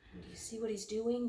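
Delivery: usual speaking voice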